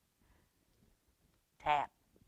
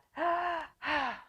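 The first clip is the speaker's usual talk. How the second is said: big sigh; 9 semitones higher than usual. Acted. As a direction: heave a big storybook sigh. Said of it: "Big Mouse sighed".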